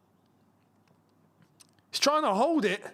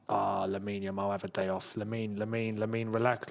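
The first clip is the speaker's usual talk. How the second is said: mock soothing; 9 semitones lower, 5 dB quieter than usual. Real, not acted. Talking to the opposing star.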